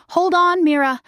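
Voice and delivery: high, loud shout while running. A narrator's reading. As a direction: yell over the roaring forest.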